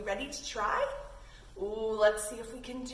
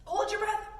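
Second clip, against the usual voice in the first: calling the cue; 8 semitones higher than usual. Shown as a demonstration. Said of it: "breathing count".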